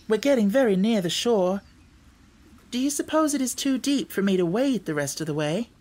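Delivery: put-on lilting, high girl's voice; Dorothy, friendly girl. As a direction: introduce yourself as a friendly young girl.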